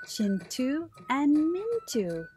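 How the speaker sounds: ordinary delivery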